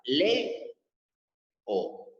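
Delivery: Le and O said as two separate beats with a pause; syllables split apart. Shown as a demonstration. Teacher showing the class, split into syllables. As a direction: break Leo into its two syllables. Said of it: "Entonces yo tengo que separarla".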